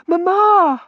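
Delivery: high, loud cry for mother; alarmed daughter. Acted. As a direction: cry out in a shrill girlish panic. Frightened daughter as mother faints.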